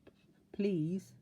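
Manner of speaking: stuttering y- and p-, frightened; quivering stammer. A narrator's reading. Mister Jelly answering the tramp, quivering stammer. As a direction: stammer the yes and please. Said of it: "Mister Jelly quivered and said".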